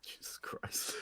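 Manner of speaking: breathless whisper of disbelief. Real, not acted. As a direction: whisper it under your breath. Reacts to letter.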